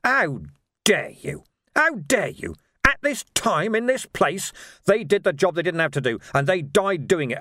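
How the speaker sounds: high, loud, indignant tirade; outraged. Acted. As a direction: explode with righteous fury. Outraged retort to memorial idea.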